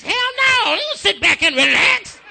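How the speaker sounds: high-pitched outraged rebuttal; preacher's mock-outraged comeback; indignant retort. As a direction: throw the phrase back in high indignation. Indignant rejection of the welcome.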